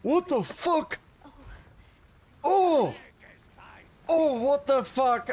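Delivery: startled high shout; alarm shout. Real, not acted. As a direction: yelp it out, shocked. Enemy surprise in game.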